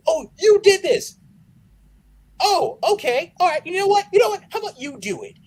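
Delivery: sarcastic outburst. Retold or imitated. Mocking ungrateful accuser.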